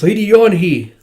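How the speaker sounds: loud, high-pitched call of a name; alarmed cry. Acted. Character calls to Lady Yonhee as she collapses, alarmed cry.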